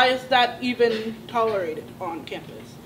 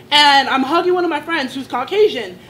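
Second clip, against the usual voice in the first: ordinary delivery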